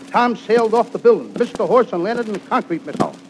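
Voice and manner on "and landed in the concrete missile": ordinary delivery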